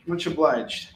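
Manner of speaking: ordinary talk, nothing marked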